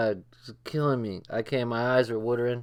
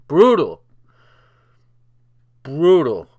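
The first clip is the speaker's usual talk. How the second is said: loud exclamation; 2 semitones higher, 9 dB louder, slower than usual. Real, not acted. Reacting to the roast.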